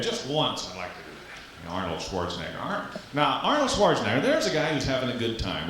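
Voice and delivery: normal talking voice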